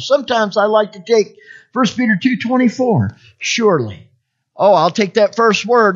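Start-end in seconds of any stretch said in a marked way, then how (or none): none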